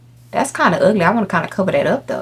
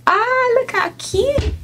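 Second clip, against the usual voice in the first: cooing; 3 dB quieter, much slower than usual. Real, not acted. Watching the bee settle in, cooing how cute. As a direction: melt over something adorable.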